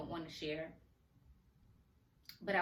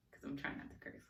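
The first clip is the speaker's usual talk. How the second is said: under the breath, softer, a quick whispered side comment; whispered aside; 4 semitones lower, 9 dB quieter, faster than usual. Real, not acted. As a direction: drop to a whisper for a cheeky aside. Whispered aside about not cursing.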